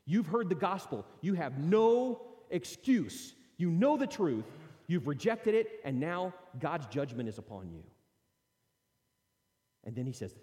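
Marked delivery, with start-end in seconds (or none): 0.1-7.8 louder, slow, hammering judgment; forceful rebuke. Retold or imitated. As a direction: pound each charge home. Paul pronouncing judgment.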